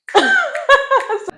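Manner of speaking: a high, real burst of laughter; real giggle after a tender admission. Real, not acted. Laughs at hurting little self.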